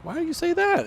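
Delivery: Why do you say that squeaked way up, loud and offended; indignant high-pitched protest. Real, not acted. To the friend who insulted his car, squeaky offended protest. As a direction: sound wounded on your car's behalf.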